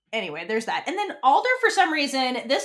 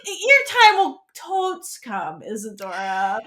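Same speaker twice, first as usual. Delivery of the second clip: laughing mock; 4 dB louder, slower than usual.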